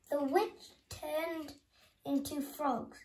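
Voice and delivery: plain reading voice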